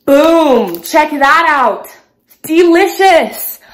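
big, loud, drawn-out showy cheer; loud and triumphant; hyped exclamation. Real, not acted. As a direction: belt it out with showy excitement. Hyped reveal to the viewers.

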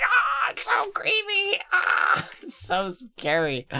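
high mock scared shriek. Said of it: "They're like".

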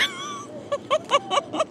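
short high-pitched giggle; high squeal of laughter. Real, not acted. Biting hard fruit.

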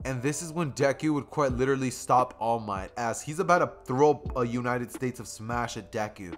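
ordinary delivery, nothing marked

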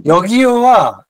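ordinary talk

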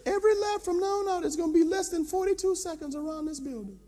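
high, even put-on coach voice; trainer's voice. Trainer announcing the lap time to the pastor, high-voiced. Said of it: "that brother says".

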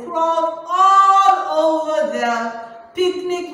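"crawled all over" stretched high and loud; swelling, sing-song emphasis on "all"; drawn-out storytelling. Acted. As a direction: sing out ALL in a long, held note. Dramatizing ants crawling in story.

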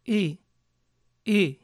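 "E" said with mouth almost closed, like short i; near-closed E. Shown as a demonstration. Teacher demos the ɪ vowel to students, careful. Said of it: "como una E con la boca casi cerrada".

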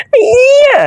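high, loud cursing yell; real shocked yell while laughing; shocked shout. Real, not acted. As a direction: scream the curse in disbelief. Gamer shocked by in-game mishap.

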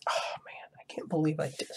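very soft, unvoiced aside; disbelieving whisper. Real, not acted. Muttering to himself in disbelief.

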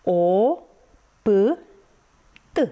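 O, P, T as separate sounds with long pauses; O held, then P and T each isolated. Shown as a demonstration. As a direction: sound out O, P, T, consonants with a short uh. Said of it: "este alcătuită din trei litere".

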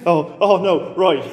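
ordinary talk

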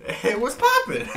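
very high, drawn-out greeting; squeaky high hello with a laugh; mock greeting. Host clowning as if greeting a caller, silly high voice.

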